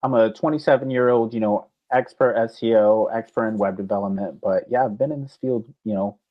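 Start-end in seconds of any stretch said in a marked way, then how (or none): none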